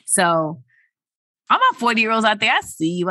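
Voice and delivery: ordinary delivery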